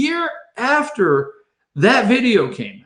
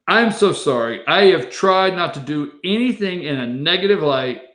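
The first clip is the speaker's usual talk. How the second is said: normal talking voice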